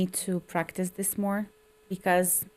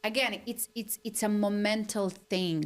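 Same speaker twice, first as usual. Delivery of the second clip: usual speaking voice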